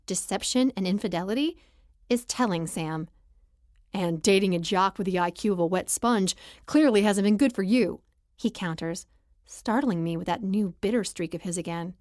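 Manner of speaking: plain reading voice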